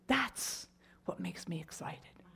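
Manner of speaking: soft voiceless awe; mother whispering her hope; hushed whisper. Retold or imitated. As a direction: whisper it like a secret joy. Mother's quiet joyful conclusion.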